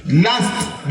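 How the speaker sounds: usual speaking voice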